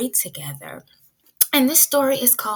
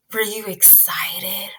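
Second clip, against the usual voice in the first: slow hushed whisper; teacher whispering to the class; 3 semitones lower, 5 dB quieter than usual. Real, not acted. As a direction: lean in and whisper the question. Teacher asks class softly.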